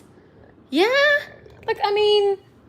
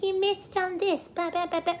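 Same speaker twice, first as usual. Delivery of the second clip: mock angry viewer; 9 semitones higher than usual. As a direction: mimic a fussy viewer nagging, pitched up. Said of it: "somebody's going to end up being angry and being like".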